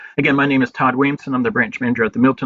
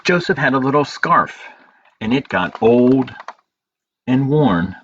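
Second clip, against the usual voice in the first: story narration; 3 semitones lower than usual. A narrator's reading. Librarian reading a picture book to children, gentle storytelling.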